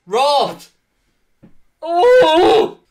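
loud high howl of injustice; outraged cry. Real, not acted. Gamer howling after losing the game, outraged. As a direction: cry out at being robbed. Loss at the end.

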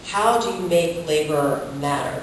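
ordinary talk, nothing marked

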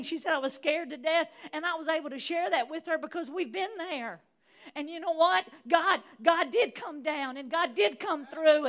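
loud, high fired-up preaching with laughs; preaching at full force. Acted. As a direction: preach it full out, shouting to the church.

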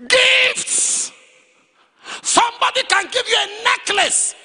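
loud, high-pitched warning; high preaching shout. Real, not acted. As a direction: cry it out to the whole congregation.

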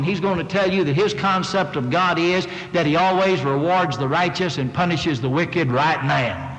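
ordinary talk

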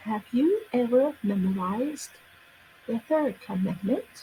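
careful model yes-no question, "commandment" rising; rising question tune. Shown as a demonstration. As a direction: lift your voice sharply on the end of "commandment". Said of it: "ขึ้นเสียงสูงนะคะ".

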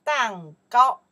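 蛋糕 called out high and loud as a model; model word, clearly. Shown as a demonstration. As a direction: say 蛋糕 loud and clear for students to repeat. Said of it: "In Chinese we say what?".

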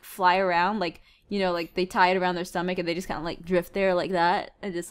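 ordinary talk, nothing marked

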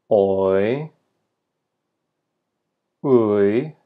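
each glide drawn out with a long gap after; "I, a, oi, ui, au, eu", held long for repeating; diphthong demo. Shown as a demonstration. Teacher giving learners sounds to repeat, slow diphthong demo. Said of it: "chance to say each following me".

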